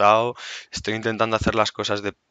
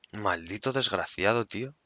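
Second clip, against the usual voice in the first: cursing under breath; 8 dB quieter than usual. Real, not acted. Cursing at enemy in game.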